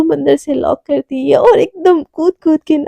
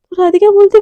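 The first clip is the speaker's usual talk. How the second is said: usual speaking voice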